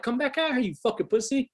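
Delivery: high, heated taunting yell; a teen yelling at a closed bus door; angry taunt. Retold or imitated. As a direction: bait him to come back out.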